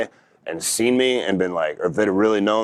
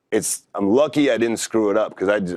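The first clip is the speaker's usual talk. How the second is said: normal talking voice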